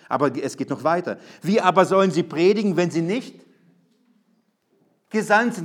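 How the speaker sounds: loud, driving sermon chain, each clause hammered; preaching at full force. Acted. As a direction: drive the logic home to the church. Builds the chain of Romans 10. (mit voller Kraft predigen)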